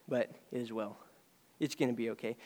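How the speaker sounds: soft and low, tender; quiet reassurance. Acted. Preacher gently reassures a grieving congregation, soft and low.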